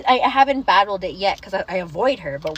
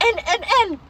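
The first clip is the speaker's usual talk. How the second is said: panicked whimper; 9 semitones higher, 4 dB louder than usual. Retold or imitated. Retold panic, no words.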